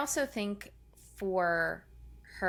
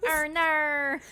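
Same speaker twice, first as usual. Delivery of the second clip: higher mock Australian "oh, no"; Australian accent; 7 semitones higher, 4 dB louder than usual. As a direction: play up a broad Australian vowel. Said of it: "Australian accent that he brings out".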